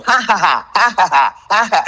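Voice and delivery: loud, high staccato cackle in short bursts; chopped-up maniacal laugh. Shown as a demonstration. Following the director's advice to chop it up, maniacal staccato laugh. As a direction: chop the villain laugh into bits. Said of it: "Chop it into bits".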